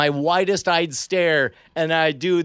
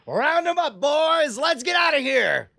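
much higher shouted order to the troops; rallying call; 10 semitones higher than usual. Acted. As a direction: call out to the gang like a bandit boss. Warlord calling to his chimp army.

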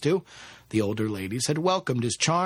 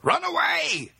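high-pitched screamed warning; frantic cry to flee, pitched way up; desperate call; slower than usual. A narrator's reading. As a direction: scream it out of the darkness. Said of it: "he heard her call once again".

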